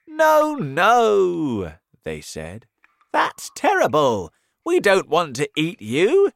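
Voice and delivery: high, loud insistent protest. Acted. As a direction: cry it out, shocked. Creatures protest together.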